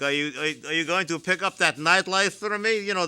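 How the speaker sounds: ordinary talk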